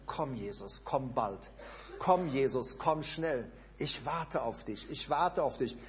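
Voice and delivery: whisper-close longing to Jesus; longing prayer. Shown as a demonstration. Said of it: "wenn du sagst".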